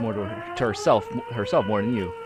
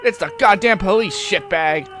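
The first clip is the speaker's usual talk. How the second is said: loud, high angry cop yell; yelling into microphone; 7 semitones higher, 7 dB louder, slower than usual. A narrator's reading. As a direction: yell it like an aggressive officer. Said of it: "She yells into the microphone".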